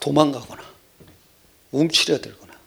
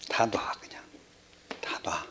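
soft whispered let it all go; whispered reply; 9 semitones lower, 14 dB quieter, slower than usual. Retold or imitated. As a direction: breathe it out like God's calm answer. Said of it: "귀찮을 때 뭐라 그러냐면".

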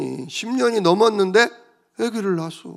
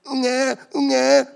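higher, slow mock wail of a newborn; baby cry; 7 semitones higher, slower than usual. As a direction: imitate a crying baby. Newborn Isaac crying. (아기 울음 흉내)

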